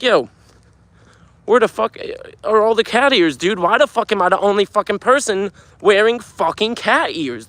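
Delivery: high-pitched outraged rant; angry. Retold or imitated. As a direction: yell it in disbelief.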